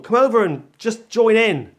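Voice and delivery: acted-out insistent coaxing; retold urging. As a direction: call someone over, imitating an eager plea. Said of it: "he was urging [name]".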